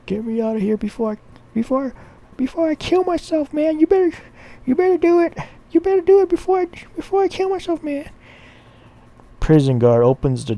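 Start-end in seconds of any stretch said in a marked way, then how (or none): 0.1-8.1 frantic high falsetto pleading; freaking out. Acted. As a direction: plead in a panicky, squeaky voice. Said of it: "starts freaking out".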